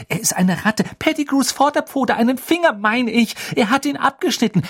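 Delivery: put-on high, loud, rushed girl's pleading; desperate Hermine. A narrator's reading. Hermine pleading urgently with Dumbledore, high and loud. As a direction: blurt it all out to convince him.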